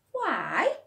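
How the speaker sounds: put-on high, loud kids' why; bright childish question; child's voice. Children asking together.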